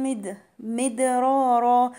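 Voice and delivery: "مدرارا" chanted slowly with a bounced d; qalqala daal. Shown as a demonstration. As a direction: bounce the د in "مدرارا", then draw out the vowels. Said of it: "والدال مقلقلة لأن هي ساكنة". ("مدرارا" بقلقلة الدال)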